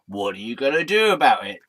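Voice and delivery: usual speaking voice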